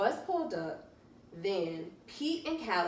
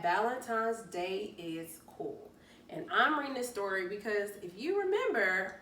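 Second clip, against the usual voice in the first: ordinary delivery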